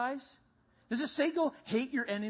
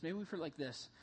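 usual speaking voice

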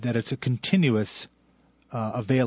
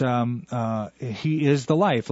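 normal talking voice

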